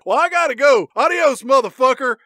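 playful action-villain goodbye; cocky sign-off. Acted. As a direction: sign off with swagger. Henchman signing off radio, acted.

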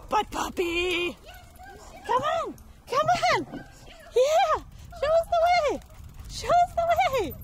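very high, excited squeal; high puppy talk. Real, not acted. Urging the puppy to show the way, high squealing puppy talk.

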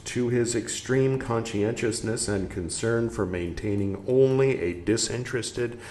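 measured, projected reading voice; emphatic phrase-by-phrase reading; book read-aloud. Acted. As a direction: read the passage out clearly and deliberately.

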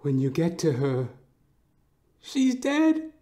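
normal talking voice

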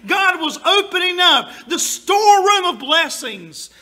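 loud, high joyful preaching; preaching climax. Acted. Pastor rejoicing to congregation, loud and high. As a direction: lift it up with joy.

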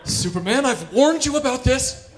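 high, loud, coy come-on; mock-sultry scolding; flirty Lois. Lois leaning on the wall.

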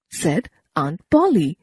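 usual speaking voice

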